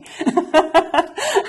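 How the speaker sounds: a quick high burst of laughter; bright laugh. Real, not acted. Mother laughing while telling about the club. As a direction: laugh out loud at the memory. Laughs after teaching a word.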